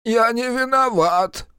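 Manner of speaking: high, slow whine; whining. A narrator's reading. Captured servant excusing himself to the others, whining. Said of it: "ныл соратник Ильича".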